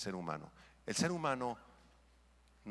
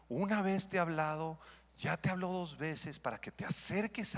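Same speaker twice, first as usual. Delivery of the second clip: rising, pleading sermon voice, higher and louder; preaching appeal; 9 semitones higher, 4 dB louder than usual. Acted. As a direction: press the listener, building with each year named. (predicación insistente y suplicante)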